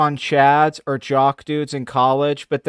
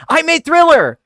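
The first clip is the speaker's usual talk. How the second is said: much higher, loud shrieked boast; John Landis shriek; 12 semitones higher, 6 dB louder than usual. Retold or imitated. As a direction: screech it like a crazed director. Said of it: "I hear John Landis sound effects".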